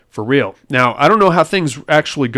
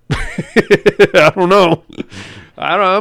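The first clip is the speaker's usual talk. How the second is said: laughing; 5 semitones higher, 4 dB louder, slower than usual. Real, not acted. Sheepish joke about his wife.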